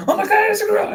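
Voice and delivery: very high, loud shocked yell; mock shriek. Friend acting out spotting the gorilla, very high mock shriek. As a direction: scream out the discovery.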